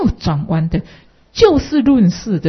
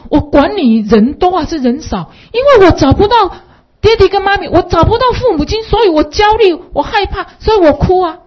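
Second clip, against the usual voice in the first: put-on high, louder child's voice; child speaking; 10 semitones higher, 5 dB louder than usual. Lost boy explaining to mother why he cried, high voice. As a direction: plead high like a scared little boy. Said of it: "他的话很贴切".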